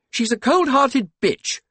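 high, loud, indignant denunciation; spitting anger. A narrator's reading. Sadie denouncing Caroline Phillips to her husband, angry spitting. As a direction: spit out contempt for a rival. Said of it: "spat Sadie".

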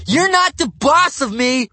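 put-on loud, high defiant kid; bratty shout. A narrator's reading. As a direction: yell like a spoiled brat.

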